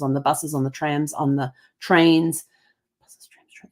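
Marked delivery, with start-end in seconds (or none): 3.0-3.7 whispered aside. Real, not acted. Repeating list to herself.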